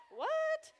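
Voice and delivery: high, loud shocked "What? What?". Retold or imitated. Youth leader retelling hearing girls say ship, high shocked disbelief. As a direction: act floored by a strange word. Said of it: "And I was like".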